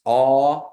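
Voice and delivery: loud clear aw; teacher leads vowel drill; Kiowa aw. Shown as a demonstration. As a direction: call aw out for the class to repeat. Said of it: "y'all can repeat after me".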